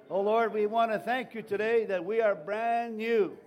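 loud, rising preacher's prayer; fervent prayer. Acted. Pastor praying aloud over his church, loud fervent prayer. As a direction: pray over the people with full force.

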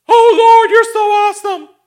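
high, loud, overblown worship; hammed-up disciples fawning over the Lord; gushing disciples. Disciples gushing at the Lord, high loud mock praise. Disciples gush after Peter's miracle.

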